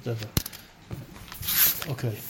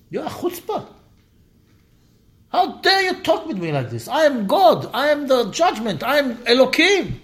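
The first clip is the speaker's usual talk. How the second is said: stern rebuke; 8 semitones higher than usual. Retold or imitated. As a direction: rebuke him for his chutzpah. God rebuking Moses sternly.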